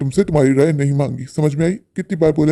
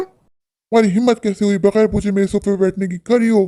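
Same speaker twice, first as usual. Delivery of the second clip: angry yell; 5 semitones higher than usual. Acted. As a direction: bark the order to stand up. Boss yells at worker on sofa.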